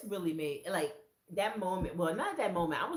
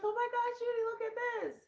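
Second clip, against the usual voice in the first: much higher, quick and thrilled; excited friend; 5 dB louder than usual. Retold or imitated. Friend pointing out her shawl, high and thrilled. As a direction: rush to show Judy.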